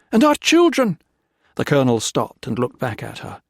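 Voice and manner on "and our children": high, loud cry; desperate plea. A narrator's reading. Wife pleads about their children, high loud cry. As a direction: beg him to stop. Wife calling after colonel.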